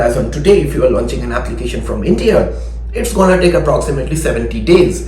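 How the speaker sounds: usual speaking voice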